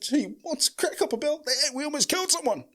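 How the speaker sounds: high mock cousin voice. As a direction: pitch it up like the cousin. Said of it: "he said".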